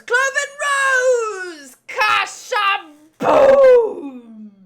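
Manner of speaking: slow, very loud battle cry, stretched out; attack shout. Acted. Voicing a fighter landing a finishing blow, slow roaring shout. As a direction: roar the attack name at full force. Dubbing an anime attack call.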